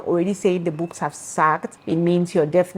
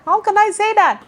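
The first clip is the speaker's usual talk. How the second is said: much higher and louder, a flustered squeal; mock-exasperated high-pitched repeat; high squeaky repeat; 14 semitones higher, 5 dB louder than usual. Real, not acted. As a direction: squeak the question out, flustered at herself. Repeats her question in a much higher voice.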